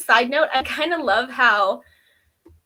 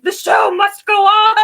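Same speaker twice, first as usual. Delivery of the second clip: high, loud, hammed-up stage cry; self-mocking cry of a talent show convert, high and loud; theatrical proclamation; 9 semitones higher, 11 dB louder than usual. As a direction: announce it like a showman. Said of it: "I'd be like".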